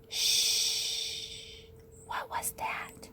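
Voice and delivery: hushed, breathy whisper; suspenseful whisper. A narrator's reading. Suspenseful hush in bedtime story.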